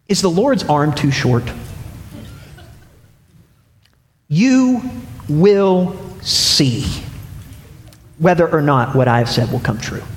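a hint of a God who means it; God answering. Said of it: "The Lord answered Moses and said".

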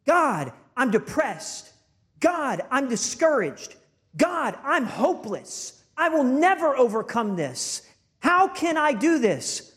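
high, drawn-out pleading to God; mock despairing plea. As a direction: wail it like someone who has given up. Voices discouraged people protesting to God.

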